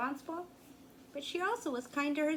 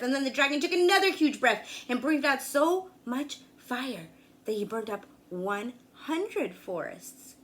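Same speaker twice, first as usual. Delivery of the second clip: ordinary delivery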